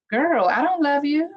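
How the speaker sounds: high, mocking retort; high-pitched mock disbelief; incredulous retort. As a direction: squeak it out like you can't believe her. Quoting Rod's reaction to nicknames.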